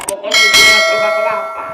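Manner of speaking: loud, fast exasperated cry; exclaiming shout. Acted. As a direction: blurt it out at full volume.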